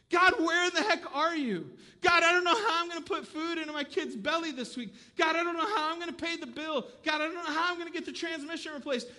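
high, loud, pleading cry to God; desperate prayer cry. Retold or imitated. Struggling people crying out to God, loud and desperate. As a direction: wail it out on your knees, desperate. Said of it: "they're praying and they're going".

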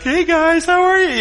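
high, squeaky little-guy voice; mini-me greeting. Retold or imitated. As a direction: greet them like a tiny kid. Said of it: "We're like".

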